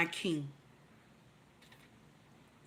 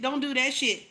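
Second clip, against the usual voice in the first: usual speaking voice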